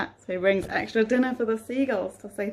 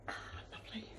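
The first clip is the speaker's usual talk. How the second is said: breathy contented sigh; 19 dB quieter, much faster than usual. Acted. Captain enjoys his tea.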